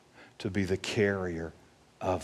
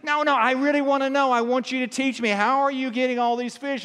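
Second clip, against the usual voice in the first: high-pitched, loud, insistent pleading; really frustrated; 13 semitones higher, 4 dB louder, faster than usual. Retold or imitated. Ice fisherman pressing the kid to teach him, really frustrated. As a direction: act out mounting frustration, demanding an answer. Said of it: "He's really frustrated now".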